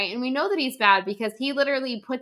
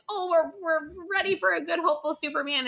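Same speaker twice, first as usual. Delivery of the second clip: higher, lightly gushing fan voice; mock eager fan; 7 semitones higher, 3 dB quieter than usual. A critic imitating hyped fans, in a higher mock gush. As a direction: imitate the hyped crowd, pitched up. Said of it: "we were all like".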